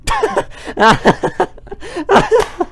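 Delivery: high bursts of laughter; laughing. Real, not acted. Player watching the crash, high real laughter. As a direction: crack up helplessly.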